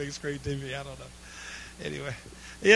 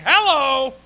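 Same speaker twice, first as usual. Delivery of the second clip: loud, high hello up the cliff; calling out; 7 semitones higher, 12 dB louder than usual. Acted. Climber shouting up for anyone else, loud call. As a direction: call out for another helper.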